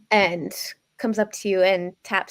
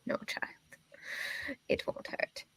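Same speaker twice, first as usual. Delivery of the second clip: put-on old lady voice; 3 semitones higher than usual. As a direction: gently whisper like a crone. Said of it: "my old lady voice".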